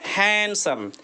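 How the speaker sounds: हैंडसम said "HAN-sum", no D; silent D. Shown as a demonstration. Teacher introduces the next word to learners, silent D in हैंडसम. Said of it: "आप यहाँ पर भी डी नहीं पढ़ेंगे".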